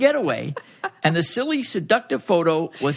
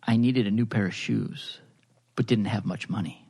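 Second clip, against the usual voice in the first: sultry storyteller voice; 6 semitones lower, 4 dB quieter than usual. Acted. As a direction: read it like a bedtime story for grown-ups. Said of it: "our Dipsy voice model here with us today".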